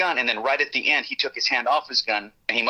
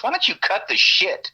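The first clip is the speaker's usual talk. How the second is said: higher-pitched retold demand, mildly raised; 8 semitones higher, 3 dB louder than usual. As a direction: imitate someone raising his voice a little. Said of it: "only time he actually raised his voice".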